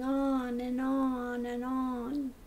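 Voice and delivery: slow, stretched-out monotone drone; mimicked droning. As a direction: drag it on and on, imitating a hypnotist. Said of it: "He uses that well-modulated tone".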